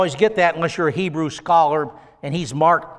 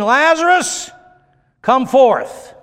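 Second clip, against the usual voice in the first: calling Lazarus out with a raised voice; loud cry; 8 semitones higher, 5 dB louder, slower than usual. Retold or imitated. Preacher retelling Jesus at the tomb, loud call. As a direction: cry out to the dead man to come forth. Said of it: "he cried with a loud voice".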